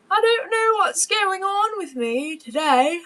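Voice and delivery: high, slow anxious voice. Real, not acted. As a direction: panicky and unsettled. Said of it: "I'm gonna have a panic attack".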